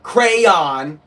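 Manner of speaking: "crayon" as a stretched CRAY-ON; rejected pronunciation. Shown as a demonstration. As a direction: overdo both syllables of "crayon", CRAY-ON. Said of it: "not crayon".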